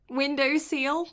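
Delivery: rising, puzzled echo of "Window seal?" with seal said like "seel"; teasing repeat. Retold or imitated. Teasing her friend over a word, rising puzzled echo. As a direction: quizzical tease on "Window seal?". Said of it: "permission to tease you about a word".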